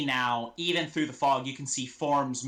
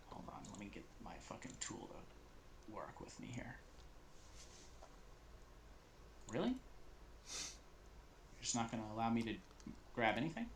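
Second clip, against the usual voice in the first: muttered aside; 15 dB quieter than usual. Real, not acted. GM's aside, fighting with his tool.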